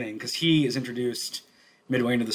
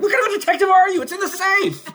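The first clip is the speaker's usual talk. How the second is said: high-pitched loud mock outrage; hammed-up shriek of disbelief; exasperated yelling; 7 dB louder, faster than usual. Club owner screaming at a clueless detective, exasperated.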